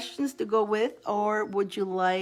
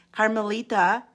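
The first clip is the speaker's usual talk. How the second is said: usual speaking voice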